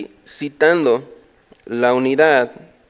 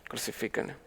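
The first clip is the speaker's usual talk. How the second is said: barely audible whisper; voiceless, very soft and low; hushed confession; 6 semitones lower, 22 dB quieter, faster than usual. Acted. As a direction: whisper the crowd's cry as a guilty admission. Confesses he would have shouted too.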